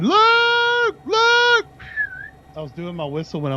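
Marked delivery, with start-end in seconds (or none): 0.0-1.7 high, loud call for a lost dog. Retold or imitated. Owner searching the streets, calling out for her lost dog in a high, loud voice. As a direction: call out across the street, much higher.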